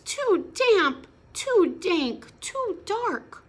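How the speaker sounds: high squeaky little mouse voice. Acted. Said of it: "Mouse squeaks".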